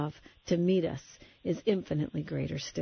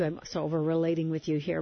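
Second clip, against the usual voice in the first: ordinary talk, nothing marked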